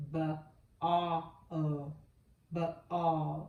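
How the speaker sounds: b, a, o said sound by sound; segmented sounds. Shown as a demonstration. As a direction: sound out b a o slowly. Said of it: "The next one is".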